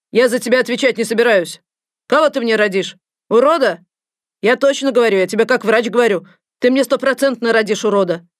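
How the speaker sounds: loud, harsh scolding rant; a scary doctor shouting at a pregnant patient. Acted. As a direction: berate her like a fed-up doctor.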